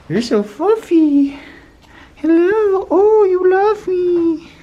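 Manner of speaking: very high, loud, drawn-out cooing; gushing pet talk. Real, not acted. Vlogger adoring the fluffy cat, squealed gushing pet talk. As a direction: squeal it slowly, smitten with the animal.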